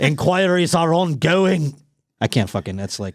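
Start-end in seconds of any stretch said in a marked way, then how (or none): 0.0-1.7 slow, higher-pitched mock Scottish accent; bad Scottish accent. Shown as a demonstration. Host redoing the police statement, in a faked Scottish accent. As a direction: draw it out in a hammed-up Scottish accent. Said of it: "redo it in a Scottish accent".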